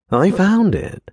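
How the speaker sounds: high-pitched, excited outburst; excited blurt. A narrator's reading. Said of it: "he blurted excitedly".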